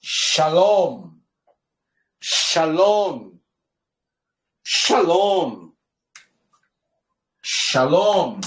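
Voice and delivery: "Shalom" with a clear initial sh, drawn out; sh sound. Shown as a demonstration. Teacher reading the written word to class, "Shalom" with clear sh. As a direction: model "Shalom" deliberately, sh as in chá. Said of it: "Por que esse som de chá?".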